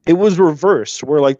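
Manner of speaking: normal talking voice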